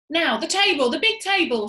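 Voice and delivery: loud, high direction; shouted order. A narrator's reading. Monkey father directing the table move, loud. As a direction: call out the next job. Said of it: "shouted Muggle Rump".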